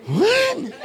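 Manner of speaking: put-on very high, squealed child's question; hammed-up high kid voice; clueless kid. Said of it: "kids, we, we do that".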